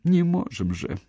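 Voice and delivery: high, taunting echo of his plea; mocking mimicry. A narrator's reading. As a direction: parrot his whining excuse back at him. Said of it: "передразнила она".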